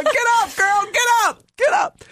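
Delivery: high, rising cheer of get up; a joyful cheer from the balcony; ancestors cheering. Retold or imitated. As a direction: urge her up from the mat. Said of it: "like, you know".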